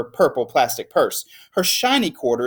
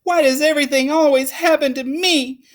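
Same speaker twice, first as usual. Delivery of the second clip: high, louder self-pitying whine; whiny child; 11 semitones higher, 4 dB louder than usual. Acted. Lily pities herself after school, high whiny complaint. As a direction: whine it like a put-upon kid.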